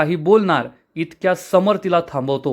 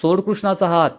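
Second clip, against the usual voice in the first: ordinary delivery, nothing marked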